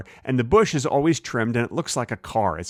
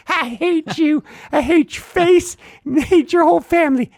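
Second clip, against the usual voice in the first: rude car bush; 6 dB louder than usual. Acted. Bush insults the driver's face, rude squeaky voice. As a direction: insult in a silly piercing squeak. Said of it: "car bush is so rude to me".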